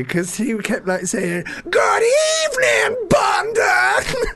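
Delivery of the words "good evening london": mangled London. Retold or imitated. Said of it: "something wrong with the way he was pronouncing words".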